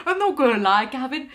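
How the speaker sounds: laughing attempt at a Welsh accent. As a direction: try a comic Welsh lilt through laughter. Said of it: "That was my attempt at a Welsh accent".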